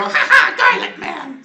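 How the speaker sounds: high, loud cackling taunt; Meryl's mocking laugh. Game master as Meryl laughing at the garlic attack, loud taunting laugh. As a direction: crow it with a big, high laugh. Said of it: "gonna kinda laugh and go".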